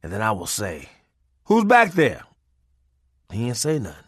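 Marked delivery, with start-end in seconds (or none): 1.5-2.2 calling through door. Retold or imitated.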